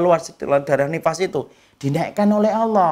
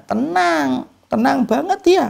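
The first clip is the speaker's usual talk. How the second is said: emphatic answer; 7 semitones higher, slower than usual. Real, not acted. Preacher answers own question emphatically.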